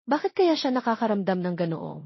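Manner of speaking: plain reading voice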